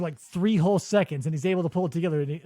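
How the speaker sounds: ordinary talk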